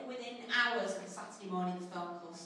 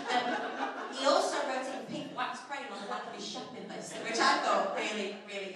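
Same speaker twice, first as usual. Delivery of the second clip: normal talking voice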